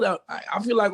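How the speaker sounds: ordinary talk, nothing marked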